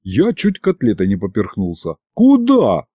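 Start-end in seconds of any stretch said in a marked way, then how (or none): 2.1-2.9 high, sudden yelp of surprise; startled question. A narrator's reading. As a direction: blurt it out, taken aback. Narrator's character startled, nearly choking.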